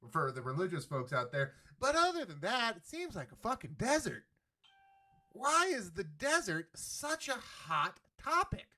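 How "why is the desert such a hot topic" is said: mock innocent. Mock naive question.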